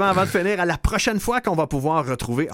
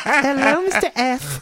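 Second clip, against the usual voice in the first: higher, coy Harley Quinn greeting; flirty Harley Quinn voice; 11 semitones higher, 4 dB louder, slower than usual. Acted. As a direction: purr it like Harley teasing her man. Imite Harley séductrice. (voix haute et taquine à la Harley Quinn)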